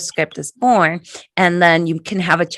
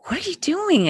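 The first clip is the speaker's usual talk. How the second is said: higher, softer, puzzled and tender; gentle mother; 11 semitones higher, 5 dB quieter than usual. Retold or imitated. Mother to her nine-year-old son, soft and puzzled. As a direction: ask it softly, like to a child.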